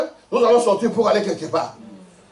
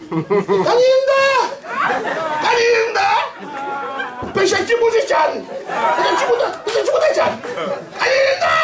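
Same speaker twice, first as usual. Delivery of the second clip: shouting from afar. Retold or imitated. Brother at the window shouting down to his little brother. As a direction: yell the name to someone far away. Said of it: "Tellement il criait".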